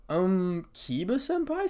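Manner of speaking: high, hesitant question; timid. A narrator's reading. Gasper nervously getting Kiba's attention, timid. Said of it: "said Gasper timidly".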